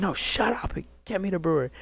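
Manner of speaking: a hint of an angry father; angry dad. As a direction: bark the order like a fed-up dad. Angry dad voiced in story.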